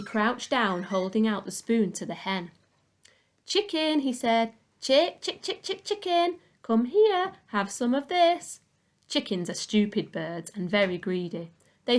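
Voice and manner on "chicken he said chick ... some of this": high, sing-song call to a chicken; coaxing the hen. Acted. Boy calling a hen over, high sing-song coaxing. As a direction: coax the hen closer, high and sweet.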